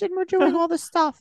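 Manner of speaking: high, hammed-up girly phone voice; squeaky fake girlfriend; mock girl voice. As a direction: play the new girlfriend, way up high.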